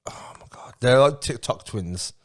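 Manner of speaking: ordinary talk, nothing marked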